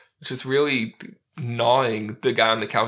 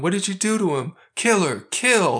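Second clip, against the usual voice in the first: higher, louder mock yell; imitated yelling; 7 semitones higher, 3 dB louder than usual. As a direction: yell it like an alarmed movie character, hammed up. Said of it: "Scarface is yelling".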